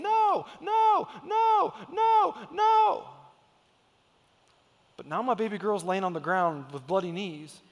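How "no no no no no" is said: put-on high, loud childish screams of no; screaming child. Dad imitating daughter screaming at him, high shouted no. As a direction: scream no like a defiant toddler. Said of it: "she's screaming at me".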